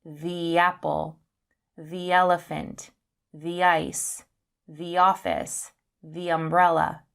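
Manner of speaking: THE as "thee" before each noun; long THE. Shown as a demonstration. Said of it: "but we pronounce it".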